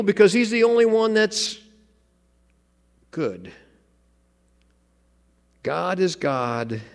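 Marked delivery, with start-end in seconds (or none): 5.6-6.8 "God is God" drawn out slowly, weight on "is"; slow solemn emphasis. Acted. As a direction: lay down "God is God" slowly, each word heavy. Key sermon statement.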